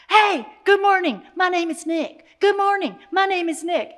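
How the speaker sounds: put-on high, loud little-boy holler; yelling greetings. Four-year-old hollering to sidewalk strangers from the sunroof, yelled greeting. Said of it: "yelling at everybody walking down the sidewalk".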